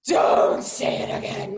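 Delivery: slow, drawn-out hoarse yell; hammed-up furious warning, loud and stretched; mad retold shout. Wife to husband suggesting coaching, mad drawn-out shout. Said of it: "Like, I was so mad".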